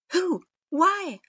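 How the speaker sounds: high, loud, urgent; alarmed questions. A narrator's reading. Naruto jumping up, demanding answers, high and urgent. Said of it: "Naruto jumped up and stared".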